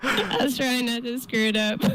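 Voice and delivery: talking through giggles; laughing at own slip. Real, not acted. Giggling after blooper.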